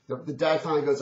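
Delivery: ordinary talk, nothing marked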